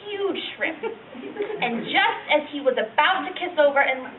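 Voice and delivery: put-on reluctant, embarrassed old lady reminiscing; old woman. Old lady telling a young interviewer about down there, embarrassed old-woman voice. Elderly woman's monologue after plain introduction.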